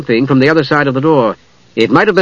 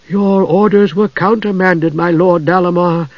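hollow voice; 5 semitones higher than usual. A narrator's reading. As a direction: answer like an echoing ghost. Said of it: "the hollow voice returned".

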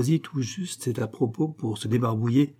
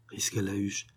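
plain reading voice

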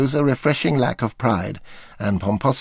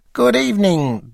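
David Frost drawl; 8 semitones higher than usual. Retold or imitated. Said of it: "drawled David".

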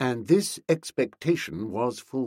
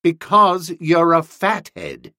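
slow, high, louder rebuke; blunt insult; 6 semitones higher, 6 dB louder than usual. A narrator's reading. Bertie explains Boko's mistake to him, bluntly insulting. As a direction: spell it out for a fool.

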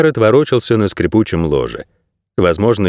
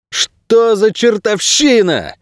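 high-pitched, drawn-out exclamation; startled cry, pitched way up; 12 semitones higher, much slower than usual. A narrator's reading. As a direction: cry it out slowly into the dark. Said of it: "вскричал он в темноту".

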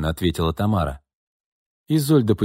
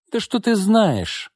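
much higher, slow scornful retort; презрительно, высоко и медленно; 13 semitones higher, slower than usual. A narrator's reading. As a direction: sneer the question at her. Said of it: "Изольда презрительно фыркнула".

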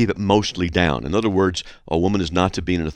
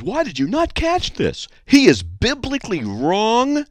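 indignant listener; 13 semitones higher than usual. Acted. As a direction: read her complaint like a scandalized accuser. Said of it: "So this lady says".

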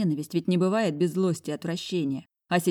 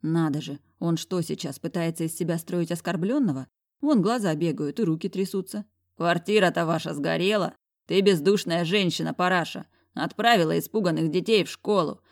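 plain reading voice

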